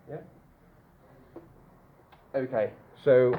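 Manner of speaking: ordinary talk, nothing marked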